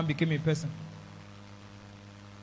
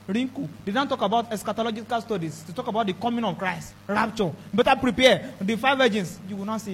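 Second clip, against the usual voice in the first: ordinary talk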